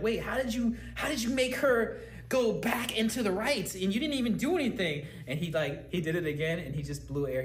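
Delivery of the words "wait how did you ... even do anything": amazed. Retold or imitated. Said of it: "I was like".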